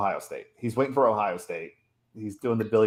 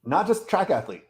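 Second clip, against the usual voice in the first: high, drawn-out indignant insistence; worked-up, emphatic rant; getting angry; 6 semitones higher than usual. Real, not acted. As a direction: pound out each fact in exasperation. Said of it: "I'm getting angry about this".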